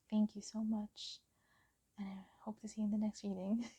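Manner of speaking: soft quick whispered goodbye; whispered thank-you and farewell. Real, not acted. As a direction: whisper your thanks warmly. Whispered sign-off.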